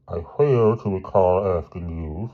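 slow, cutting rebuke; a touch of icy authority, drawn out; stern headmaster. Acted. Headmaster rebuking the boy who broke in, slow and stern.

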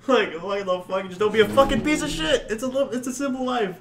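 high-pitched, incredulous rant; exasperated plea. Real, not acted. Guest ranting about living simply, high exasperated plea.

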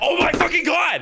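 high, stunned elation; excited shout. Real, not acted. Friend reacting to the shared Boston trip, excited shout. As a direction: yell it, blown away with joy.

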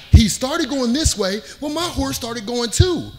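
normal talking voice

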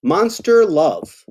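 slow, dramatic title read; title announcement. Acted. Author presents the book title to kids, slow and grand. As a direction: savor the title slowly.

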